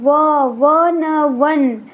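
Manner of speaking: वन built up from व and न; sounding out one. Shown as a demonstration. Teacher sounding out one for the children, sound by sound. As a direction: build वन slowly, from व, then न. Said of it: "sound क्या बनेगी O N E की?".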